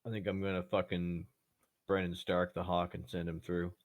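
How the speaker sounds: ordinary talk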